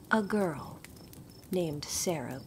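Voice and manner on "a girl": plain reading voice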